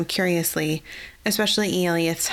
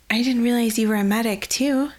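higher, rising in surprise; surprised; 5 semitones higher than usual. Acted. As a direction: sound caught off guard by the news. Eliath surprised at Marcone's skill.

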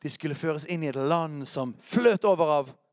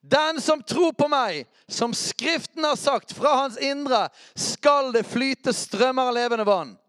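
high, drawn-out shouted proclamation; loud shouted quote; 11 semitones higher, 8 dB louder, slower than usual. Acted. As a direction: shout the scripture out, stretching the words. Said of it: "så må vi rope".

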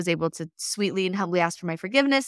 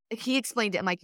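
usual speaking voice

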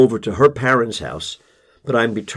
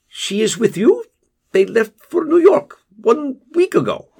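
Casper hedging to the father, higher and level; Casper's voice; 11 semitones higher than usual. Acted. Narrator voices Casper on phone.

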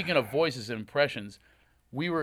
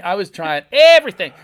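ordinary talk, nothing marked

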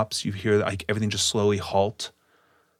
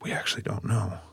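hushed; 5 dB quieter than usual. Acted. Grave hushed answer.

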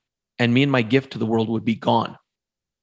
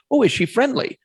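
bright high-pitched coo to the dog; high sing-song dog-lover voice; high friendly question; 11 semitones higher than usual. Retold or imitated. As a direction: lilt it up high, sweet to a dog. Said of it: "I asked, I said".

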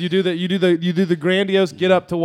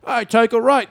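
put-on a light Australian accent on "I take a right"; Aussie-accented navigation voice; 6 semitones higher, 3 dB louder than usual. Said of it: "his like".

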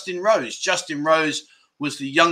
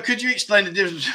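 reading the line through laughter, fast; can barely get it out for laughing; laughing while reading; 5 semitones higher, faster than usual. Real, not acted. Streamer reading a cheeky viewer question, laughing.

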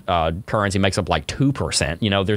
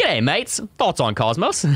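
a higher, playful Australian lilt on "G'day, mates"; mock Australian accent; 8 semitones higher, slower than usual. Shown as a demonstration. Showing the cohost how to read it in Australian accent. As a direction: show him how to do it in Australian. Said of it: "You gotta read the whole thing in that accent".

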